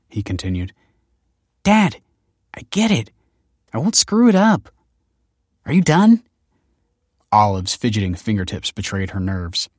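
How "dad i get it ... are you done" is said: put-on high, impatient teen retorts; exasperated. A narrator's reading. Daughter sick of her father's lecture, exasperated. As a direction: cut him off, pitched up and louder.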